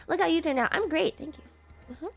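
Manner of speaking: ordinary talk, nothing marked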